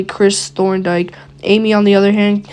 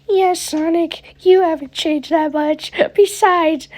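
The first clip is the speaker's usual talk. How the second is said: put-on high, slow girlish Amy voice; 9 semitones higher than usual. Amy reassures Sonic he hasn't changed, in a high girlish voice. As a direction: pitch way up into a sweet girl. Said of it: "these voices".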